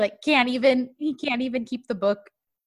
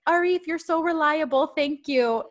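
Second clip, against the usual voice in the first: high-pitched, mock admiring voice; admiring praise; 7 semitones higher than usual. Host retells her praising the swim teacher, admiring. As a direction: praise him in a higher voice.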